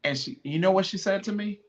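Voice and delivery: ordinary talk, nothing marked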